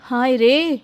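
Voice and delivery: plain reading voice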